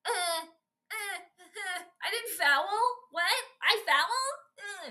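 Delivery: hammed-up fake outrage; mock protesting player. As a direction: play the player who swears he didn't foul. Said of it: "it's like".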